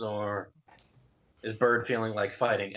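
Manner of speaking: ordinary delivery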